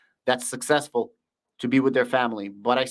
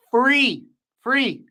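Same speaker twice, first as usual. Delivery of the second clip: emphatic free; 7 semitones higher, 5 dB louder than usual. Real, not acted. Hyping free Discord to viewers.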